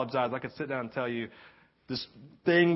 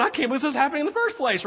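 high, fast, incredulous rush; amazed disbelief; 10 semitones higher, much faster than usual. Retold or imitated. Preacher watching his own dream, rushed astonishment. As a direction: blurt it out, pitch way up.

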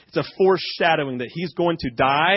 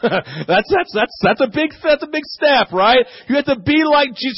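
ordinary delivery

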